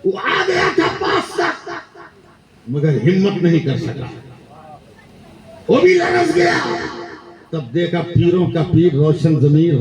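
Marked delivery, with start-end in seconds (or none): 0.1-1.6 high, slow cry with a laugh; high drawn-out cry. Real, not acted. Preacher telling of the saint, high slow cry. Preacher voices rising spiritual climax. 5.7-6.6 high loud shout; preacher's shout. Real, not acted. Preacher describing the faltering saint, high shout. Preacher voices rising spiritual climax.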